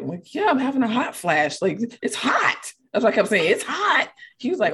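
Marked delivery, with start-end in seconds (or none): 0.3-2.7 higher, mock-exasperated retort; irritated. Woman on the phone explaining her hot flash, acted irritated. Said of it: "You sound like you're irritated". 3.5-4.1 high-pitched exasperated insistence; irritated. Retold or imitated. Insisting to him about her hot flash, acted irritated. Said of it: "You sound like you're irritated".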